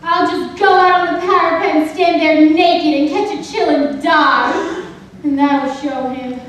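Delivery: loud, dramatic self-pity; wounded, noisy declaration; petulant outburst. Acted. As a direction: rant a melodramatic threat. Petulant threat after quarrel.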